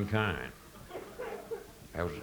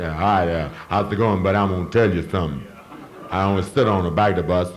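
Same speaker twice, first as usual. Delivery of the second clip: higher, louder Southern caricature boasting he owns the town; mocking Southern voice; 4 semitones higher, 5 dB louder than usual. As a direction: ham up a boastful Southern local. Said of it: "still say".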